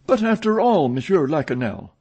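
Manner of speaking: high, loud exclamation; cried out. A narrator's reading. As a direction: cry it out insistently. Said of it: "cried Richard".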